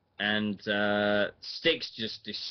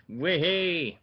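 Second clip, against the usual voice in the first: gleeful whoop; 6 semitones higher than usual. Real, not acted. Cheering eight hours in.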